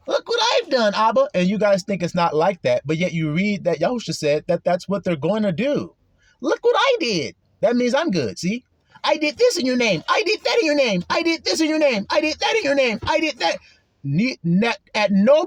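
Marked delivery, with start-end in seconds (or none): 0.1-1.3 high, smug bragging voice; mock boastful. Proud believer bragging to God, high mock boast. 6.4-13.6 high, smug bragging voice; mock boastful. Proud believer bragging to God, high mock boast.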